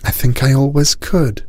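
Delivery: put-on high girl's voice; little girl voice. A narrator's reading. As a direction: say it simply as a child. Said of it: "said Cinnamon".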